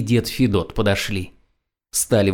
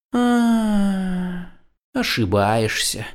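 sigh; 10 semitones higher, much slower than usual. Acted. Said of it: "вздохнул кот".

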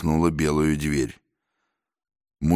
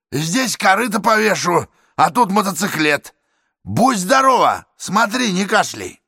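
loud, higher, cocky man's voice; a swaggering boor, self-satisfied; brash working man; 13 semitones higher, 4 dB louder than usual. Acted. Man in cap leaving.

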